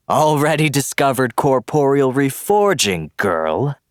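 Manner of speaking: high, loud gleeful villain; cackling villain. Acted. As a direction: crow it out, delighted and cruel. Villain taunts dragon girl.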